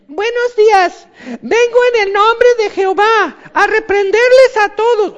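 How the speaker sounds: put-on a little boy trying to sound official; timid boy prophet. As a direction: Jeremiah as a squeaky kid scolding a nation. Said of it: "es como si el Kiko".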